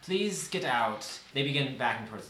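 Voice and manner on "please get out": raised character voice, loud at first; starts high and loud, eases into a plea; researcher's firm dismissal. Acted. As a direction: order the intruders out firmly. Researcher orders the party out.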